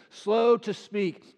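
drawn out, very slow; slow to speak. Shown as a demonstration. Preacher dwelling on slow to speak, very slow. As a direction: stretch each word of "slow to speak". Verse said slowly.

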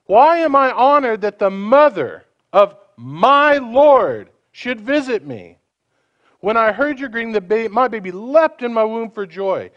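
solemn scripture read, slowed down. Acted. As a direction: proclaim it as holy speech. Reads Elizabeth's prophecy.

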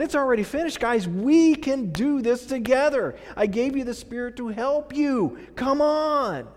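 rally the team like an encouraging coach; heartfelt, high-pitched urging; rousing encouragement. Acted. God voiced cheering people on.

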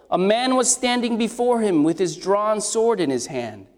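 usual speaking voice

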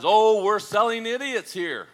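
slow, loud and high, savoring the insult; smug punchline. Acted. Businessman mocking the customer, loud and slow. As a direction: drop the line like a proud zinger. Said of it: "One of the men replies".

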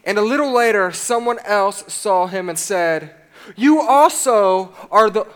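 loud, deliberate Bible reading; preacher reading scripture aloud to his church, firm and loud. Acted. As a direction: read the passage out to the congregation. Continues reading Luke aloud.

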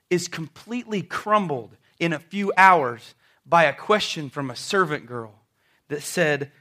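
ordinary talk

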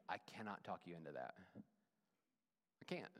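ordinary talk

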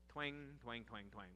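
low, quiet guitar-twang imitation; mimicked country twang. Preacher mocking a country song's chorus, low offhand twanging. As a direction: toss off soft, low twangs like a country lick. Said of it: "however that goes".

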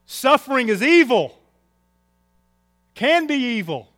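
loud, high preaching declarations; forceful preaching. Real, not acted. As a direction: drive each point home from the pulpit.

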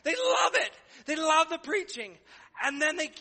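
high, loud, excited preaching. Acted. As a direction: lift it up high and loud with joy.

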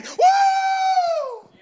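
very high, loud, sustained whoop; praise whoop. Shown as a demonstration. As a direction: let out a long ringing "woo" of praise. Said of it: "the best praise word that I can".

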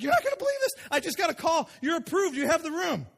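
high, bright-voiced announcement; thrilled woman announcing approval; excited news. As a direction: burst out with the good news. Woman brings the good news.

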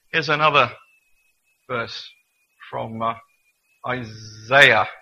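Isaiah said as eye-ZAY-uh in a New Jersey accent, loud. Shown as a demonstration. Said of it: "I'm working on my New Jersey accent".

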